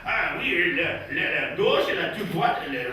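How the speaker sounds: softly babbled French caricature, stumbling on repeated words; mock French. French local giving cathedral directions, mock French. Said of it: "always have to say their country".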